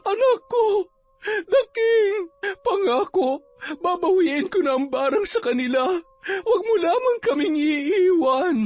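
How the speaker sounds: tearful, high-pitched pleading; crying. A narrator's reading. Sonia begging the unconscious baby not to leave, crying. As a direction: wail and plead through tears in a high voice. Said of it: "Umiiyak siya habang itinataas baba".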